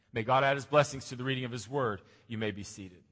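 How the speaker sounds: normal talking voice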